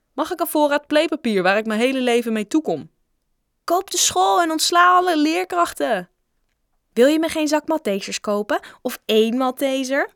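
put-on high, loud begging child; clamouring children. A narrator's reading. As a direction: badger the rich kid eagerly. Opdringerige kinderen bestoken rijke Joe. (zeurend kind, hoog en hard)

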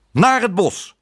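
high, loud command; shouted order. A narrator's reading. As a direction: yell the order furiously. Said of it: "schreeuwde hij".

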